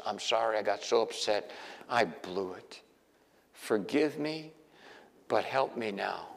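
lightly voiced contrite prayer. A believer apologizing to the Lord, softly contrite. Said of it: "prayer of apology voiced".

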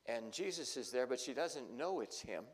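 normal talking voice

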